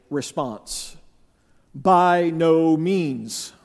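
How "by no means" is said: drawn-out, emphatic refusal. Acted. Paul answering whether to continue in sin, slow emphatic rejection. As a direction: hit each word slowly and firmly. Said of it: "there's a very emphatic, and I do mean emphatic".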